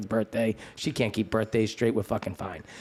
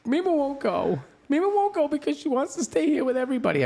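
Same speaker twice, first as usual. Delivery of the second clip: high-pitched imitation of a niece; niece's voice; 12 semitones higher than usual. Niece explaining why grandmother won't die, high voice.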